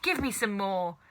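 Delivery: put-on demanding old witch voice; greedy hag. A narrator's reading. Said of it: "she cried".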